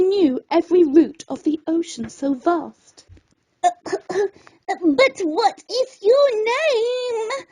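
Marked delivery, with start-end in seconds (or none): 3.6-7.5 slow, high, calling after her; small Snippy calling after the departing lobster; trailing little voice. Acted. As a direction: stretch the question out as she leaves. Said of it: "Snippy's voice trailed on after".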